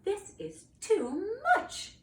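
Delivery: slow, high-pitched protest; exasperated knight. Acted. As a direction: draw out each word in exasperation. Knight exasperated by second roar.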